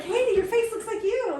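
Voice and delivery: high, loud, astonished compliment; amazed friend. Retold or imitated. Friend amazed at Katie's new face, loud delight. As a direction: gasp it out in delight. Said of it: "one of her friends was like".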